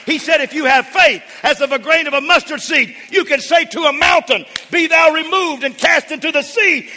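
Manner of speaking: high, loud, driving sermon delivery; full-force preaching. Real, not acted. Preacher urging congregation to have faith in God, full-force preaching. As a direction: build to a preacher's shout and hammer it home.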